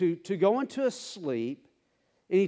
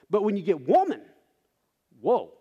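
rising excitement, louder on woman; building to Isha; 5 dB louder, faster than usual. Shown as a demonstration. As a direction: lift the crowd toward the long word. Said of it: "let that last syllable just linger".

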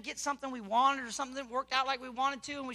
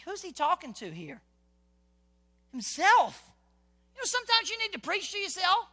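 ordinary delivery

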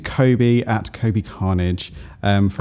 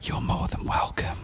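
soft, airy mock whisper; hushed, playful and breathy reply; put-on whispery voice; 10 dB quieter than usual. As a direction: echo the whispered cartoon voice. Answering in the same put-on voice.

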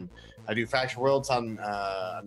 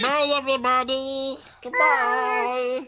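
talking doll; 13 semitones higher, 5 dB louder, slower than usual. Acted. Signing off in put-on voice.

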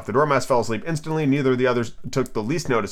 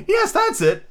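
very high, sighing Hatter; plaintive, resigned character voice; slower than usual. Acted. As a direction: sigh the words out in a high voice. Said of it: "said the Hatter with a sigh".

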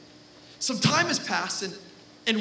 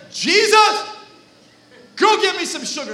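calling out; 8 semitones higher, 5 dB louder than usual. Acted. Mother calling son again.

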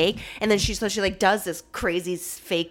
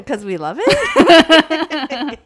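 laughing high and loud; 8 semitones higher, 7 dB louder, much slower than usual. Real, not acted. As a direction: answer through a laugh. Laughing at her own answer.

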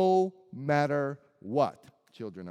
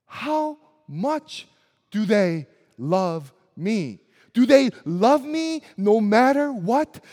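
put-on child's worried questions; 4 semitones higher, 5 dB louder than usual. Voicing a child's anxious questions.

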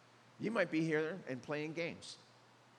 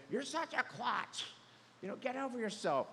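higher-pitched mock scolding; scolding put-down; 6 semitones higher than usual. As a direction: toss out a retold insult, pitched up. Quotes someone's insulting put-down.

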